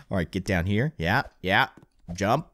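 ordinary talk

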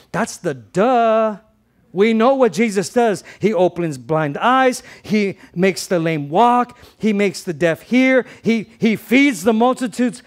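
loud, high, slow preacher's cry; preaching at full force. Real, not acted. Pastor shouting to the congregation about Jesus' miracles, loud and high. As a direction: preach it out to the whole room.

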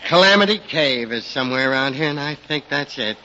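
usual speaking voice

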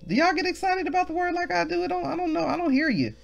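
high-pitched, rapid gush; excited teasing. Real, not acted. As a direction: squeal it lightly and quickly. Teasing congregation about missing excitement.

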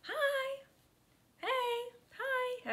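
very high quick hellos; forced cheery hi. Retold or imitated. Passing guests at the party, high forced cheery hi. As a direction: chirp hello while hurrying past.

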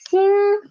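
"xin" held high and level, first tone; teacher's high level first tone. Shown as a demonstration. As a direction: sing "xin" high and flat. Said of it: "Read after me, please".

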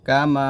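কামা with a drawn final a; measured কামা, lengthened at the end; one-beat elongation. Shown as a demonstration. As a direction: hold the last vowel of কামা for a beat. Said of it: "এক লিপ টান দিবেন".

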